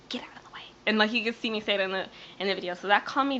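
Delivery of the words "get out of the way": retold angry whisper, no voice; very soft, voiceless mock scolding; mad whisper. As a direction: whisper it as a cross order. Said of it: "I was so mad".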